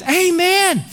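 high "Amen" tossed in for support; mock amen. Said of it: "I think that translates".

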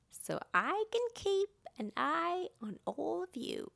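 loud, high, grand announcement; booming storybook race official; Owl, announcing. A narrator's reading. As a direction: call out the race rules to everyone. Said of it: "Owl announced that he didn't want".

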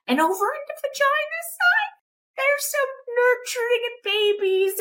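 mock girly falsetto. Describing the pink toy aisle, mock girly falsetto.

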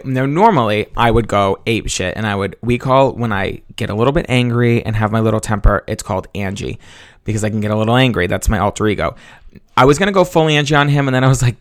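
normal talking voice

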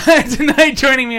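high laughing tonight; laughing as he retries the intro; laughing restart. Real, not acted. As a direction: burst out laughing on it.

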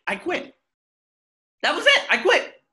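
high, loud declaration of quitting; acting out storming off the job; quitting outburst. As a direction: throw it in their faces.

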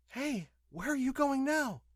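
high-pitched woman's voice, questioning; calling woman. A narrator's reading. Woman calls after the departing pilot, questioning.

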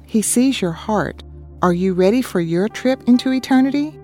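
ordinary talk

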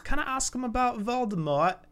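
put-on very high, soft child voice; little girl voice. Ginny begs her mother, very high soft child voice. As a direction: ask like an eager little girl.

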